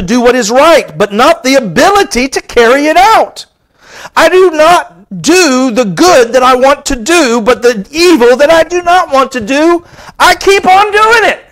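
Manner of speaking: fervent scripture reading that climbs to a peak; preaching scripture. Acted. Preacher reading Romans to his congregation, building fervor. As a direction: preach Paul's words, pressing harder each line.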